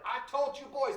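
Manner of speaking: put-on a mom catching kids misbehaving, scolding them. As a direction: scold the boys like an exasperated mom.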